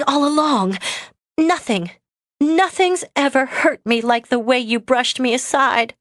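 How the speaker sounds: hurt outburst. Acted. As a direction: pour out years of hurt. Autumn's hurt outburst at Lucas.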